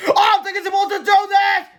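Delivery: high, loud yell over a roaring machine; shout over noise. A narrator's reading. George shouting over the roaring machine, loud and high. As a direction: bellow it over the din. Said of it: "shouted George over the horrible noise".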